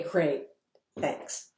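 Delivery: ordinary delivery, nothing marked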